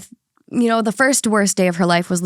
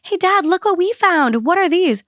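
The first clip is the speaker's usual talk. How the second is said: lightly voiced curious kid, higher pitch; 8 semitones higher than usual. Kids showing dad the found gifts, higher kid voice. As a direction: chirp it up like a child showing a find. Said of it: "were like".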